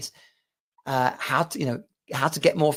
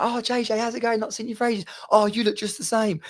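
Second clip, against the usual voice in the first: higher, bright imitation of an old friend; higher, lightly mocking catch-up chatter; mock friendly greeting; 8 semitones higher than usual. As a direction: gush at an old acquaintance. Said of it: "they'd be like".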